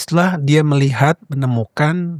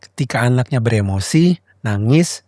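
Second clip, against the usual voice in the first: ordinary talk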